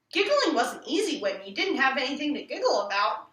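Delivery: ordinary delivery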